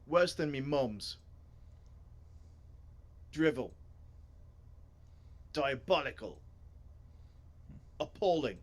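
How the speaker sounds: scornful put-on British-style voice; disdainful story character. Reader as a character scorning the book sale, disdainful voice. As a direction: deliver each verdict as a separate put-down.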